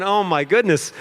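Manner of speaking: high, panicked gasp of words. Retold or imitated. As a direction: say it in rising panic. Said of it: "I was panicking".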